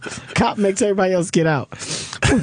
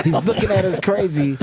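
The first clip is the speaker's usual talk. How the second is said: normal talking voice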